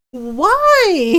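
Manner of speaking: high, loud drawn-out protest; mock exasperation. Friend reacting to silly obscene texts, mock exasperation. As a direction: wail it in playful disbelief.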